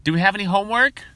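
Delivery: high, loud eager question; mocking the keener asking for homework; teacher's pet. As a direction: ask it like the keen kid nobody likes. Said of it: "the kid in the class who's like".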